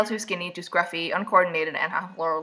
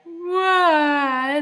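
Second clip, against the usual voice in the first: high drawn-out fake cry; badly faked bawling; mock wail; 8 semitones higher, 4 dB louder than usual. Heroine having a good cry, mock wail. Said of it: "I just need a good cry".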